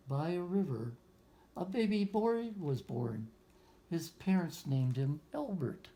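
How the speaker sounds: put-on reading a picture book aloud to children; story narration. A narrator's reading. Teacher reading a picture book to young students, storytelling voice. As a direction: lift the lines like a storyteller for a class.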